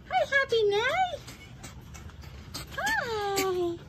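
tiny high squeaky coo; squeaky pet voice. Real, not acted. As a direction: squeal with delight at the dog. Squealing greetings to the dogs.

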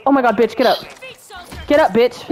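usual speaking voice

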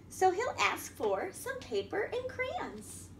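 lively picture-book reading voice; reading to children. A narrator's reading. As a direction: read it out to a room of little kids.

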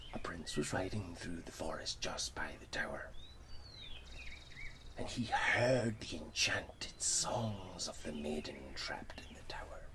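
hushed, breathy story narration; whispered storytelling. A narrator's reading. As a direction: whisper the tale like a secret.

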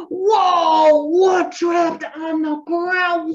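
big gasp, then loud, high wonder; exaggerated shock for a child; excited astonishment. Shown as a demonstration. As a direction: draw it out, amazed. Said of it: "I'm gonna be making myself really excited".

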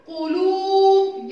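قلوب slow, with a long loud "luu"; قلوب chanted long; long vowel. Shown as a demonstration. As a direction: stretch the waw in قلوب on a high note. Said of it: "طويلة".

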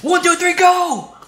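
high, loud chugging countdown; hyped countdown. Pretend. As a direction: count it off like a dare. Counting down to chug.

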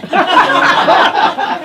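high, breathless cackle of laughter; a delighted breathy laugh. A narrator's reading. Harmony laughs at her choice.

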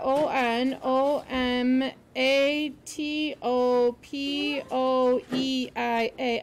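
slow, letter-by-letter spelling. Shown as a demonstration. Teacher spelling a hard word for students, slowly letter by letter. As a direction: spell it out slowly, evenly spaced.

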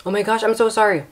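high, quick embarrassed sorry; flustered apology. Real, not acted. Streamer apologizing to viewers for the mix-up, flustered and high. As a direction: gush out the apology.

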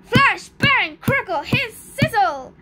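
loud, punchy storybook exclamations, each word separate; dramatic sound words. A narrator's reading. The spell goes off on the monster, dramatic exclamations. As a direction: fire off each magic noise with a high, loud attack.

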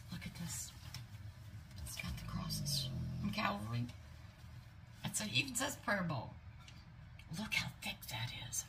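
soft, hushed awe; reverent whisper. Real, not acted. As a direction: whisper it like in church. Touched by prayer bowl.

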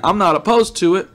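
usual speaking voice

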